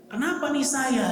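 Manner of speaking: high-pitched retold fearful question; imitated alarm. The Prophet telling his wife of his fear, acted alarm. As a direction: voice lifted in acted worry. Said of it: "Rasul takut ketakutan luar biasa".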